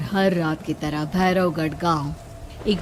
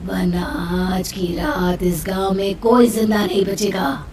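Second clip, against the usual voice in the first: menacing ghostly voice, whispering then booming louder; eerie witch voice; 2 semitones higher, 5 dB louder than usual. Acted. A witch's voice on the phone threatens the father. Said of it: "एक बेहद डराने वाली आवाज".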